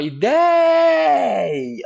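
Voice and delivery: loud, high shout stretching the "dei"; drawn-out holler on the stressed middle of "ideia"; calling out the word. Shown as a demonstration. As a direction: call "ideia" out with a long strong syllable. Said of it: "chama a palavra".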